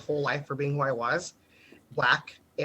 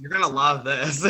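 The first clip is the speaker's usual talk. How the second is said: chuckling through the words; laughing tease; 4 semitones lower than usual. Real, not acted. Teasing a juicy story.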